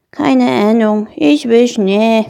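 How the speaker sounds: a hint of a gloomy small dragon; lightly voiced little dragon, glum. Gloomy Christmas dragon is asked for an opinion, glum.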